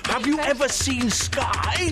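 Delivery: high, drawn-out daft questioning; mock lunatic. Imagining what a frantic typist would ask, mock lunatic voice. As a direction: put on a slow, oddball crank voice. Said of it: "someone who types like that".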